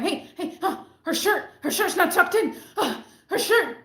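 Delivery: high, mocking tattletale voice; high mocking snitch voice; mocking the manager. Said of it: "she's like".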